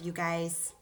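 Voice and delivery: usual speaking voice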